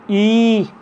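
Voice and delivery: ई held long and high; long vowel ई. Shown as a demonstration. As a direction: stretch out ई. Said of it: "बोलने में अधिक समय लगता है".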